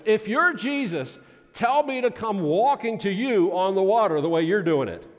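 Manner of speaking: high, loud bold call across the water; hollering. Retold or imitated. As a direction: shout a dare out over the storm. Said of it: "he hollers out".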